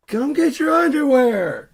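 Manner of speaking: high, full-volume angry yell; mad wife shouting. Acted. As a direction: order him back at the top of your voice. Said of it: "she's really mad at him".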